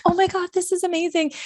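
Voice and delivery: high, breathless wonder; lightly acted amazement, pitched high; mock awe. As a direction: gush like a wide-eyed newbie. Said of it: "And I was like".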